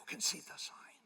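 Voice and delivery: breathy whisper back; whispered reply. Retold or imitated. Pastor whispers back to his lawyer at the council. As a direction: whisper it under your breath. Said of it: "I go".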